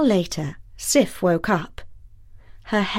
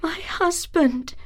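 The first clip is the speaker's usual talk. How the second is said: higher, soft, tearful voice; a mild sob on the words; sobbing; 8 semitones higher, 3 dB quieter than usual. Acted. Sif sobs to her husband from the shadows. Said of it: "she sobbed".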